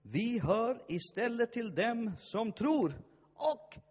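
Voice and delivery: soaring shouted preaching, each vinner a cry; preaching at full force. Real, not acted. Pastor rallying the congregation, shouting at full force.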